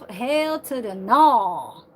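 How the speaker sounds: NO drawn out and loud; slow, emphatic refusal landing hard on NO. Real, not acted. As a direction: stretch out NO with force.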